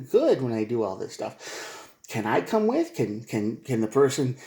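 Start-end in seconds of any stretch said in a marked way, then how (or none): 2.1-2.9 high, small begging question; pleading ask. Retold or imitated. Left-out partner asking to come, high pleading.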